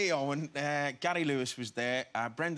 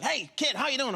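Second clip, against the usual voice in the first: imitating Scorsese; 11 semitones higher, 5 dB louder than usual. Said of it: "and he's like".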